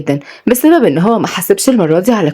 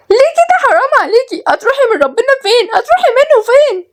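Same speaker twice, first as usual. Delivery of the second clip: put-on piercingly high girlish squeal; squealed, hammed-up tantrum; shrieking protest. A narrator's reading. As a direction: wail in outrage as if robbed. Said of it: "وكلهم صرخوا".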